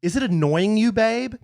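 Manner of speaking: acted annoyance, pushed up at the end; annoyed. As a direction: read it as a needled wife. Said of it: "parentheses, annoyed".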